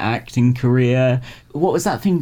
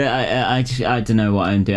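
ordinary delivery

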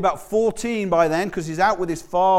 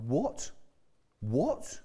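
quiet, rising, incredulous question; understated indignation, asked softly; a soft, disbelieving query as imitated indignation; mildly indignant; 8 dB quieter than usual. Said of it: "Jesus was indignant".

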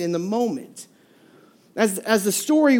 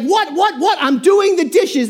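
higher, indignant mock protest; defensive husband; 7 semitones higher, 4 dB louder than usual. Husband snapping back at wife, mock indignant. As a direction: act the put-upon husband. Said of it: "he's like".

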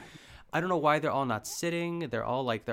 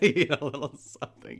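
laughing echo; 2 semitones higher, 5 dB louder than usual. Real, not acted. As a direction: repeat it through real laughter.

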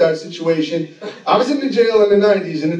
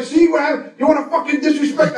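mock-angry cop, pitched up; higher-pitched irate scolding, acted out; getting madder; 5 semitones higher than usual. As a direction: imitate an exasperated officer losing his temper. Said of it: "this guy's getting madder and madder".

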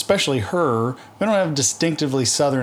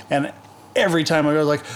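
ordinary talk

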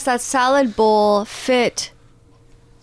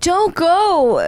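high, mock-desperate plea; pleading; 6 semitones higher, 3 dB louder than usual. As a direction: call him back, begging in a raised voice. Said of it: "I was pleading with Brian".

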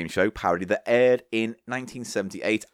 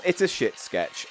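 usual speaking voice

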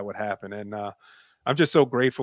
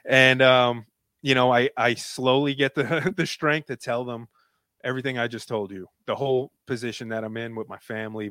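usual speaking voice

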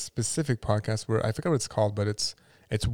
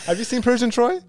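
excited question; 12 semitones higher, 6 dB louder than usual. Real, not acted. Excited laughing question to friends.

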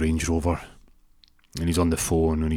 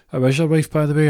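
higher voice; 8 semitones higher, 4 dB louder, faster than usual. Retold or imitated. Other man asks, retold.

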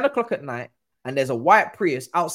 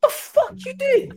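high-pitched, drawn-out outraged question; hammed-up incredulous challenge; mock disbelief; 5 dB louder, slower than usual. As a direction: imitate a driver aghast, voice shooting way up. Retold driver's shocked challenge.

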